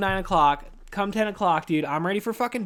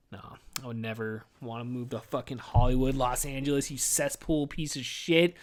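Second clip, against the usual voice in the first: usual speaking voice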